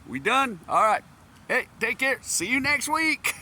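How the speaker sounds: high, loud bright exit; breezy cheerful goodbye. Retold or imitated. Teacher leaving after four hours, high and upbeat. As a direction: chirp it on the way out.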